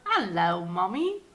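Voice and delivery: imitating a British-sounding toddler, rising at the end; mock British. Parent copying the child's hello, mock British accent. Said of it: "he sounds so British".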